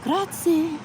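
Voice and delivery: put-on Italian accent, pitched high and sung out; "Grazie" with a proud, melodic Italian lilt, rolling the vowels; best Italian accent. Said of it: "but in my best Italian accent".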